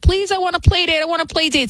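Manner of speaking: put-on a small girl asking for a play date, pleading and high; little girl begging. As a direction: beg like an eager little girl. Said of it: "She was like".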